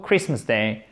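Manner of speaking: Christmas stressed on CHRIST, no T sound; silent T, first-syllable stress. Shown as a demonstration. Teacher showing the rule, Christmas with silent T and first-syllable stress. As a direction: say Christmas as KRISS-muss, short I. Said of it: "l'accent tonique sur le première syllabe".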